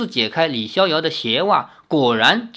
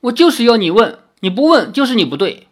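loud, higher-pitched hurt insistence; acted petulant protest, pitched up; aggrieved, near tears; 7 semitones higher, 6 dB louder than usual. A narrator's reading. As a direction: insist loudly, wronged and upset. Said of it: "委屈的想哭".

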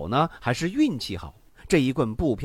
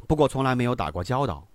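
ordinary delivery, nothing marked

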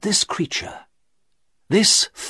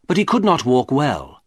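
plain reading voice

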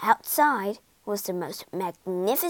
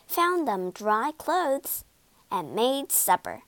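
plain reading voice

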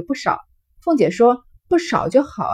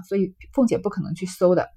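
ordinary delivery, nothing marked

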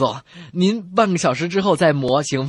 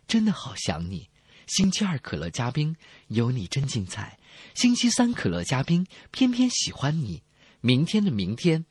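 softer, tender romantic ad read; parody love poem; 3 semitones lower, 5 dB quieter than usual. Acted. Comedy host reciting a romantic cola advert, softly.

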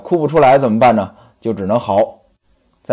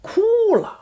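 mock crying; 5 dB quieter than usual. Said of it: "这一委屈".

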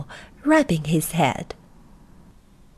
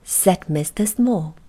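ordinary delivery

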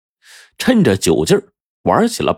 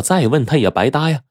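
ordinary delivery, nothing marked

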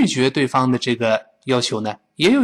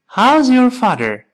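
"How's your" linked, higher voice, falling on father; a bit quicker; 9 semitones higher, 4 dB louder, slower than usual. Shown as a demonstration. Teacher speeds up the question for students, a bit quicker. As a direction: model it a little faster, still clear. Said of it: "快一些".

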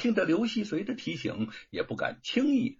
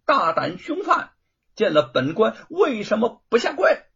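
loud, higher official bark; louder and higher court rebuke; magistrate's shout; 7 semitones higher, 6 dB louder than usual. Acted. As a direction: thunder at the prisoner from the bench. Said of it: "范谷喝道".

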